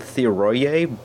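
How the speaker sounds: Theroye as thee-uh-roy-AY; tentative film pronunciation. Shown as a demonstration. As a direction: end Theroye on a sounded "ay". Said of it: "I think they pronounce it".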